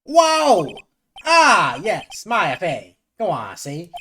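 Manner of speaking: very high, loud shocked whoa, then slow yes; startled old lawyer. Acted. Old lawyer stunned to hear Maya Fey named, loud yelp. Grossberg shocked at Maya's name.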